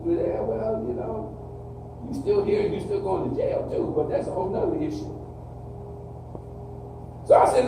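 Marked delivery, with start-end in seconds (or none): 0.1-5.1 soft, breathless sarcastic aside; sarcastic murmured retort. Retold or imitated. Counselor answering the guys who say we still here, with a soft sarcastic jab. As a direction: hush the comeback like a private jab.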